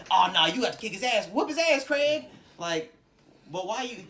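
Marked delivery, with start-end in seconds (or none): 0.1-2.2 loud, high egging on; hyping up. Retold or imitated. Friends gassing Craig up to fight, loud hype. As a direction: cheer him into the fight.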